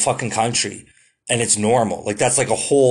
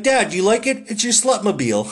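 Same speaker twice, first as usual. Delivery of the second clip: much higher, giggling mock Tom Green taunting dad; cheeky son voice; 8 semitones higher, faster than usual. Tom Green teasing his furious dad, high and giggly. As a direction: play the bratty son showing off the car, laughing.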